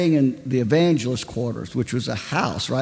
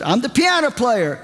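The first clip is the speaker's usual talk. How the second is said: high, teasing question; woman on the phone teasing the preacher; playful woman caller; 9 semitones higher than usual. Retold or imitated. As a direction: tease him in a high woman's voice. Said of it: "she tells".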